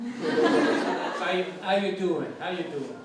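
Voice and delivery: New York accent greeting; playful New York accent demo. Shown as a demonstration. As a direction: put on a New Yorker's "how you doing". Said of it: "a New York accent would be more like this".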